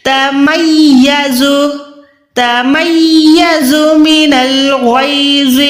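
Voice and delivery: तमय्यज़ु slow, voiced from the mouth without nasal ghunna; mouth not nose. Shown as a demonstration. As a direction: chant तमय्यज़ु slowly with no nasal hum. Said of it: "कैसे अदा करेंगे?". (मुंह से तमय्यज़ु)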